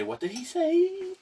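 slow imitation, shooting up high; meme catchphrase. Friend explaining the meme, high drawn-out imitation. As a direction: stretch the last word high in mock disbelief. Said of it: "they would say".

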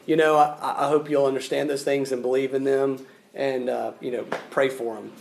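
low, soft, offhand delivery; re-enacted casual pitch, voice sinking away; half-hearted invitation. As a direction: mumble a tepid, noncommittal appeal. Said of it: "sharing the gospel and just going".

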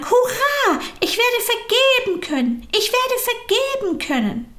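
very high, excited sing-song cheer; joyful cheer. A narrator's reading. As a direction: cheer it, jumping with joy. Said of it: "sprang umher und sang". (hoch, jubelnd, fast singend)

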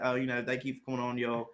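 flight attendant's announcement in mock English; put-on English accent. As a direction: slip into a loose fake English accent. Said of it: "Oh, he's English or something".

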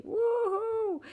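high, gleeful whoop; excited cheer. Real, not acted. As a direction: cheer it like a win. Cheering about not failing.

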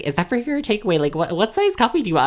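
high, lilting customer-service voice; exaggerated own work voice. Barista asking a customer about takeaway, exaggerated high voice. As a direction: pitch it way up to take an order.

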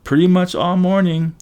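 high-pitched lightly acted girl; girl's voice. A narrator's reading. Girl answering her flustered friend, high voice.

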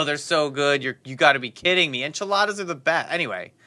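ordinary talk

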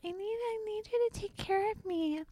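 high, whiny mock plea; mock needy plea. Mocking the apologizer who wants comfort in a high whiny voice. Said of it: "the intention behind it is".

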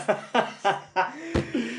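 high breathy laughter; laughing. Real, not acted. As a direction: keep laughing. Keeps laughing after the joke. (risa aguda)

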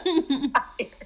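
high-pitched laughing protest; laughing too much to say it straight. Real, not acted. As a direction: say it through real laughter. Laughing at own protest of tiredness.

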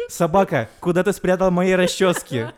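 slow, loud scolding through laughter; mock angry mom. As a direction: scold like an outraged wife. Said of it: "начала спрашивать, говорить".

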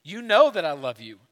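grieved. A narrator's reading. Peter answering Jesus after the third time, grieved. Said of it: "And Peter was grieved".

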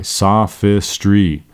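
"Sophistry." said very slowly, syllable by syllable; slow pronunciation. Shown as a demonstration. Teacher pronouncing sophistry very slowly for the class. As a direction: draw out "Sophistry." carefully. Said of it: "Our next word is sophistry".

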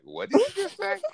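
squeaky high retold double take; shocked Falco. Falco turning on Kaya, high startled retold question. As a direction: snap round in alarm, way up high. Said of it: "Falco turns around and is like".

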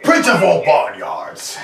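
slow, loud, lordly boast; mock Vegeta voice; Chris Sabat voice. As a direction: declaim it like a proud prince. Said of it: "sounds like Chris Sabat".

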